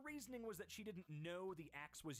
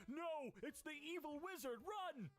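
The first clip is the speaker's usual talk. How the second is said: mock panic; 11 semitones higher than usual. Fleeing the evil wizard.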